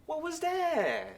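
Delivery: high, slow, offended what; outraged at a tiny peck; indignant surprise. Acted.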